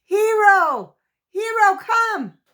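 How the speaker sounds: very high, loud, drawn-out call; slow, stretched shout to a far-off dog. A narrator's reading. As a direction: yell for the dog from inside the trap. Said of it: "called for the hair puller again".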